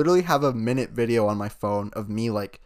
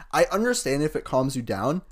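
ordinary talk

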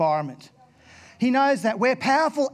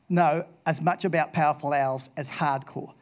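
ordinary delivery

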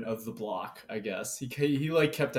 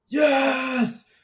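put-on much higher, louder, yelling like a kid at tennis practice; mock cheering shout; 12 semitones higher, 7 dB louder than usual. Tennis kids shouting outside the dorm window, loud cheering. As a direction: whoop it like a teen who just won a point. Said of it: "kids are just going".

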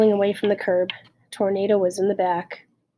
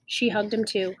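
ordinary delivery, nothing marked

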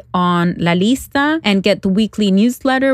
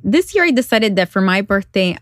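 normal talking voice

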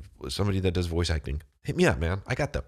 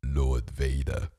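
very low, slow, drawn-out villain voice; the Emperor; 7 semitones lower, much slower than usual. Acted. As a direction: command it slowly from deep in the throat. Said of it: "then the Emperor, all you hear is his voice, he goes".

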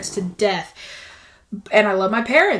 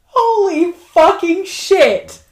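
shocked exclamation; 8 semitones higher, 6 dB louder, much slower than usual. Real, not acted. Hamster suddenly appears under door.

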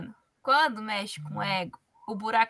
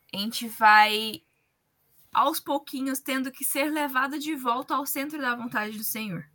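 ordinary talk, nothing marked

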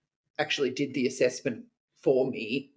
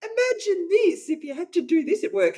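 horrified squeal; 5 dB louder than usual. Retold or imitated. Telling husband, horrified.

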